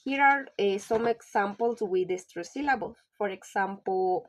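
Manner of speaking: ordinary delivery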